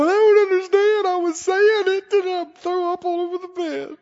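high-pitched, whiny complainer; mock whining. Preacher imitating people who lose the battle, high mock whine. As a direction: imitate a wailing, self-pitying moan.

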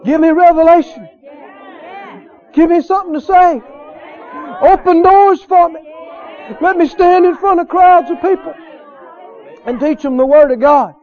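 high, ringing declarations; fervent preaching. Real, not acted. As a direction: call out each gift with a pause after. Preaches through God's gifts to him.

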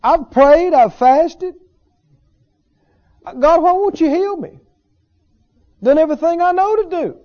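upset whining. Retold or imitated. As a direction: act out a frustrated believer griping at God. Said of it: "I can just get upset and go".

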